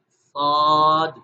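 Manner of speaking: "صاد" said very slowly, with the long alif held. Shown as a demonstration. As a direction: drag out the "صاد" vowel. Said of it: "الف کی آواز کے ساتھ پڑھنا ہے".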